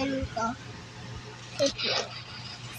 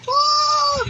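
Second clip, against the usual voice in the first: loud, high exclamation; startled "oh"; 11 semitones higher, 5 dB louder than usual. Real, not acted. Player reacting to the game mid-chat, startled high cry.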